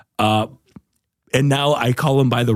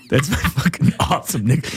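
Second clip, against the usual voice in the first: ordinary talk, nothing marked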